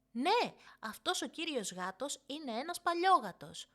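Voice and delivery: put-on a toddler struggling with r and l; little girl lisping. Youngest sister backing up the story, childish and high. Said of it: "παλεύοντας ακόμα λίγο να προφέρει το ρο και το λάμδα".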